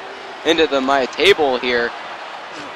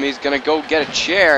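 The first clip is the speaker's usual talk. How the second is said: ordinary talk, nothing marked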